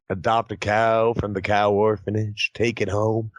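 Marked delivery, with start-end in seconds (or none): none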